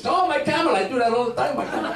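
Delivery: high, hammed-up foreign local voice; a lightly put-on Middle East villager; playful impression of a local; mock camel-owner voice. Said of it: "go like this".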